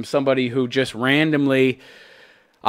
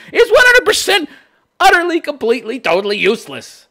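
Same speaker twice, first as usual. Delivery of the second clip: high, louder sarcastic emphasis; 12 semitones higher, 6 dB louder than usual. Real, not acted. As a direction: pile on the mockery. Sarcastic build of intensifiers.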